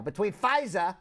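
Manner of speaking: Long Island accent, dropping the r in Pfizer. Shown as a demonstration. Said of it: "I'm using my Long Island accent now".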